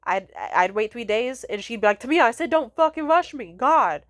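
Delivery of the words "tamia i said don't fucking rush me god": loud, high imitation of an exasperated mom; mom snapping. Mom snapping at daughter for asking again, loud angry imitation. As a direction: escalate into an angry scolding. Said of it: "As if she was gonna fucking beat my ass".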